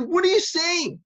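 loud, high-pitched outburst; screamed challenge. Retold or imitated. Rabbi challenging a student's unproven idea, screaming. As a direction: yell it in disbelief at his nerve. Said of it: "He screamed at me".

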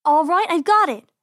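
high, loud and eager; bright-idea exclamation; sudden idea. Acted. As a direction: announce a plan with a burst of confidence. Rabbit sister has an idea.

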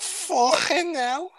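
very high, drawn-out fake Scouse; overdone plastic Scouse; bad Scouse accent. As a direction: stretch it into a silly Scouse squeal. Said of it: "someone trying to do a Scouse impression".

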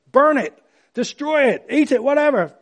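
emphatic preaching. Real, not acted. Preacher urging a clean break.

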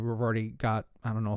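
ordinary delivery

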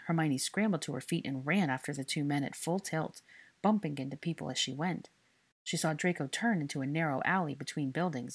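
usual speaking voice

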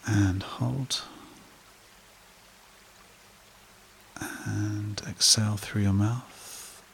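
slow meditation voice with long pauses; guided meditation. Acted. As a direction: guide listeners gently into calm, phrase by unhurried phrase.

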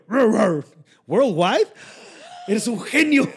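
loud barking dog impression. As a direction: bark it out like a dog. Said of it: "el perro dijo".

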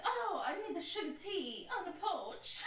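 hammed-up Southern belle accent. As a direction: play a Southern lady. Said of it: "those Southern belles".

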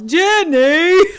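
high, loud holler stretched over two syllables; imitating a bellowed call; sudden yell. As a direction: yell the name out, high and drawn out. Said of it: "all of a sudden yells".

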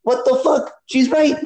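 high, indignant exclamation; mock the moment it clicked, voice jumping up; retold outburst. Caller imitates his own reaction to her podcast, exclaiming high. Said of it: "I go".

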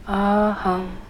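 ordinary talk

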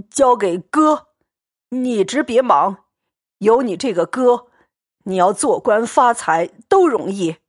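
put-on boisterous, fawning drunk bully, laughing as he talks; a tipsy braggart wheedling his friend to stay; drunken Xue Pan. Drunk Xue Pan grabs Xianglian and begs him to stay, boisterous and fawning.

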